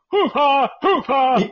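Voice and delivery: loud, high barked "hoo-ha"; imitated vocal tic. Storyteller mimics mom's neighbor slapping his chest, imitated vocal tic. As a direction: imitate a sudden involuntary outburst, twice over. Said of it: "and he'd go".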